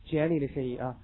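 usual speaking voice

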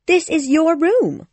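put-on high, smiling girl's voice; Wendy. A narrator's reading. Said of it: "Wendy smiled".

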